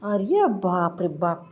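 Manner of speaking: slow, drawn-out mock amazement; sister-in-law relishing the scandal; sarcastic gloating. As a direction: savour the gossip. Sister-in-law gloats over the news.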